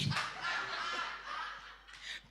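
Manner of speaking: a high, breathless burst of laughter. Real, not acted. Laughing while telling the family story. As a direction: laugh at the memory of it.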